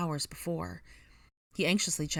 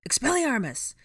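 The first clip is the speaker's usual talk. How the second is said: high, forceful battle cry; loud casting yell; shouted spell; 9 semitones higher, 6 dB louder than usual. Acted. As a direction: hurl the spell at an enemy. Spell cast in a fight.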